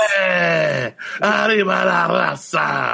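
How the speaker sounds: hammed-up cartel rally cry; mock Mexican cheer. Joking about a cartel faction with a mock Mexican shout.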